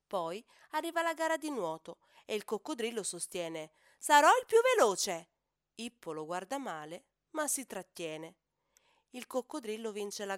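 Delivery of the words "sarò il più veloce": loud, high brag, falling at the end; boasting shout. Acted. Crocodile bragging before the swimming race, loud. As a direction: announce your win before it happens.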